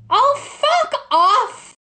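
high, loud, drawn-out yell; slow, outraged high shout; exasperated shout. Real, not acted. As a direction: yell it in stretched-out disbelief. Exasperated at the answer.

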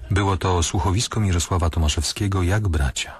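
ordinary talk

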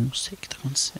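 soft, low, baffled murmur; half-swallow it in disbelief; stunned murmur. Retold or imitated. Comedian baffled by the silent mother, soft stunned murmur.

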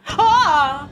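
sharp startled yelp; short scream. Acted. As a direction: yelp like you're scared. Mock scream in scary intro.

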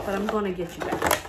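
usual speaking voice

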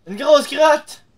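loud high goofy yell; silly shout. Real, not acted. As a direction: shout it like a joke. Joking shout at monster.

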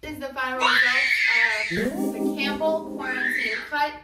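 loud laughing reveal; laughing announcement. Real, not acted. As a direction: announce it, cracking up.

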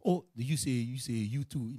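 normal talking voice

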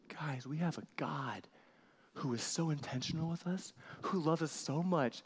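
ordinary delivery

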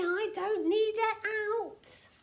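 high-pitched stubborn insistence; huffy, whining denial; sulky protest. A narrator's reading. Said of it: "sulked Tiny".

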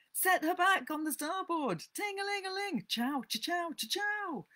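play-acted captain and engine; boy playing steamboat captain. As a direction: call the order high, then chant the engine noises. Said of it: "He was boat and captain and engine bells combined".

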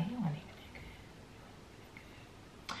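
very soft, muttered dismissal; dismissive breakup line. Retold or imitated. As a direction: mumble it like consoling yourself after a breakup. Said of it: "you break up with somebody and you be like".

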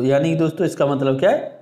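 ordinary delivery

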